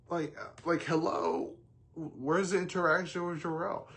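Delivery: high-pitched incredulous demand; indignant disbelief. Real, not acted. Fan demanding the missing scene from the film, indignant. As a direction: pitch it way up, calling out the obvious.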